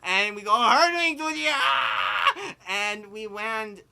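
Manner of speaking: hammed-up terror while retelling the flight; dramatic slow telling. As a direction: stretch it out like the car is flying. Reliving car hurtling through air.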